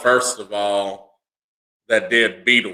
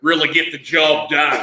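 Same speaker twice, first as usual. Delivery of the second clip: higher-pitched Aussie sign-off; Australian accent; 4 semitones higher than usual. Acted. Capping the mock explanation, in Australian accent. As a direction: finish the bit as an Australian. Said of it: "why I like that accent".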